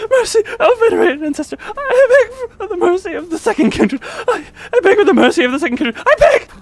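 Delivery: high, loud, hammed-up pleading wail; begging fiend. Streamer voicing the kneeling blood fiend begging the ancestor. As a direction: grovel and beg for mercy.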